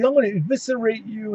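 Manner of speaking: a light go at a creepy cult leader threat; hammed-up sinister promo line; mock menacing wrestler. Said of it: "so he's".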